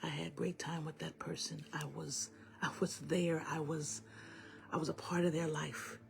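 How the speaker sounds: soft, half-whispered comfort with long pauses; hushed healing message. Real, not acted. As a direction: guide them gently through grief, very quietly.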